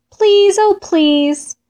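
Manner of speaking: high, slow begging; pleading. A narrator's reading. Fox and Mole ask Llama for help, pleading. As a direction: draw out a sweet plea.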